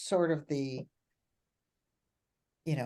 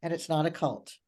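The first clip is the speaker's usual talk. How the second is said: usual speaking voice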